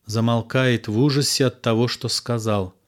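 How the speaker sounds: usual speaking voice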